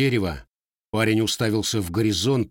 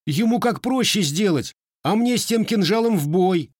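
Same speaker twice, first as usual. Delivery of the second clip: higher, louder youthful outburst; boyish protest; 10 semitones higher, 3 dB louder than usual. A narrator's reading. Grandson protests to his grandfather, higher and louder. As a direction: protest hotly like a teenage boy.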